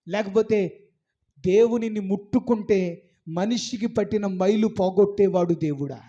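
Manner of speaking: ordinary delivery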